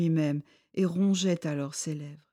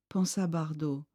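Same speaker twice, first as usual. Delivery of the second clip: ordinary delivery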